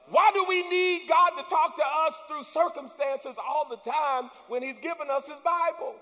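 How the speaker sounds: loud, high, driving sermon climb; full-force preaching. Acted. Pastor rousing the congregation, preaching at full force.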